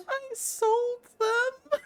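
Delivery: high, slow, giggly admission; sheepish boy leader. Acted. Boy leader admits selling fleets, high and slow. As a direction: own up like a guilty child.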